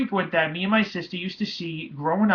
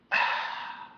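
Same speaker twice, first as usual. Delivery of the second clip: long airy sigh, no voice; imitated exhaling sound; 5 dB quieter than usual. Son imitating the sound that came out of his mom, long voiceless exhale. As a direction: imitate breath leaving a body. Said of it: "I could hear this actual".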